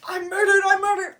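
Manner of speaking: loud, high hammed-up shriek; mock alarmed cry. Desdemona waking up, mock shriek of murder. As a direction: cry out in play-acted alarm.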